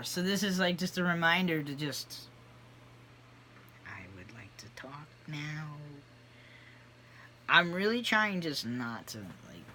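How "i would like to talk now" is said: near-whispered, tentative voice; timid request. Acted. Reminder to speak up in group, timid whisper. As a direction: ask very softly, almost whispering. Rehearsing raising their hand in group.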